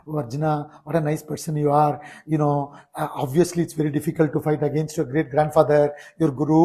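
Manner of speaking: mock-tender soft pleading, not how Krishna speaks; mock soft coaxing. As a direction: coax him softly as if flattering a child. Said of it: "He doesn't talk like that".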